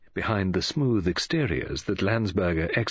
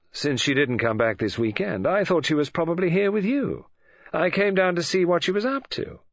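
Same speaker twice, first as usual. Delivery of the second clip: put-on higher-pitched, unruffled young intruder; cool young man; 8 semitones higher than usual. A narrator's reading. David explains his visit to Mary, coolly. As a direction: answer her coolly in character.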